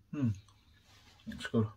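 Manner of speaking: chewing while talking; muffled through food; mouth full. Real, not acted. Seen 0: Food put in mouth at -1.0; lips closed, jaw working after.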